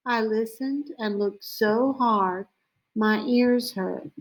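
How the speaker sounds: plain reading voice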